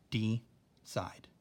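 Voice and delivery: "D side" split in two by a clear pause; syllable break. Shown as a demonstration. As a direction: say "D", stop, then "side". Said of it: "That's where the syllable is".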